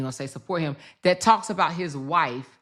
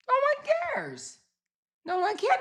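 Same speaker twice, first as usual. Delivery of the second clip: dismissive. Real, not acted. Scoffing at his engagement.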